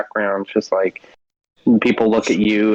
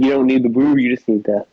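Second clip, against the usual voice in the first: ordinary talk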